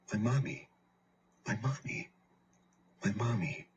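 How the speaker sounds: put-on small, high, quiet child's plea; grieving child softly calling mommy; crying for mommy. A narrator's reading. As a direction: whimper it like a lost child. Child crying for dead mother.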